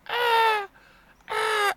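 very high whimpering gasps; high mock sob. Upset at the restaurant, high mock whimper. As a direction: whimper like you're about to cry. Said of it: "fiquei mesmo".

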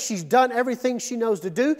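ordinary talk, nothing marked

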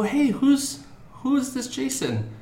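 high, coaxing classroom voice; teacher questioning child. Retold or imitated. Teacher bringing up Jason while painting, high coaxing teacher voice. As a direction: imitate a teacher casually probing a small child.

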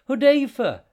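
high desperate scream of a name; calling out. Acted. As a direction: scream his name for help. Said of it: "she cried".

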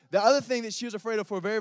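ordinary talk

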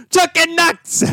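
very high, drawn-out catchphrase yell; mock yell. Shown as a demonstration. Host demonstrating the wrestling catchphrase, high drawn-out mock yell. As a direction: yell it like a wrestling battle cry. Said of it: "you're gonna yell, "Chucking Nucks".

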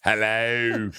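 drawn-out hello in put-on Aussie; Australian accent. Comedian keeps failing at London accent, stuck in Australian. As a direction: aim for London, land in Australian. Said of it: "Why am I stuck in Australia?".

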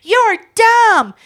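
loud, high mock child outburst; bratty crying child. Four-year-old insulting the adult mid-tantrum, bratty mock outburst. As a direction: throw it out like a tantruming kid. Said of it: "starts crying, and she's like".

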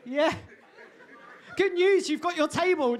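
mock his jumper back at him; teasing wife. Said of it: "And Hannah said".

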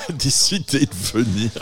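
en riant. Real, not acted. Annonce d'un invité surprise mystérieux.

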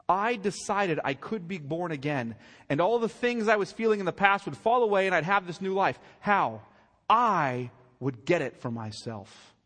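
loud, high voicing of his words; mocking quote. Preacher quoting the singer's testimony, loud and scornful. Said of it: "You hear that".

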